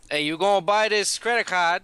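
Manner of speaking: put-on Italian accent. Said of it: "Italian [name]".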